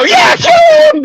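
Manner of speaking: put-on a gang of small kids whooping agreement; kids cheering along. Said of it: "they all turn into little kids for a second".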